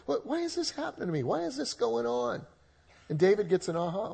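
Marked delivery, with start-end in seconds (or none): 0.1-2.5 high, fast, soft mock whine; bewildered complaint. Preacher imitating a struggling believer, mock-whining high. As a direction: pitch it up like someone baffled by their troubles.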